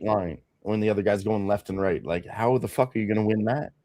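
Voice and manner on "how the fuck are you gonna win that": normal talking voice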